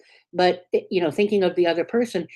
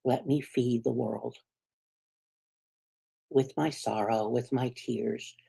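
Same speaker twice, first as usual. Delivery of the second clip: tender plea; 5 semitones lower, 7 dB quieter than usual. Retold or imitated. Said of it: "your body is trying to say".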